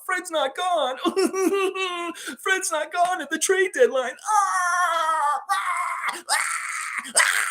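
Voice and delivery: high sobbing, shouting tantrum voice; crybaby tantrum. Pretend. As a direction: wail like a toddler losing it. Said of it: "little baby motherfuckers".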